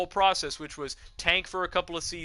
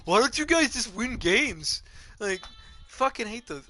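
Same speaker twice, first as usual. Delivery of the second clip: badly done mock player gripe; bad player impression; 7 semitones higher than usual. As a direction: grumble like a jaded retired star. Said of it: "I know it was a stupid impression".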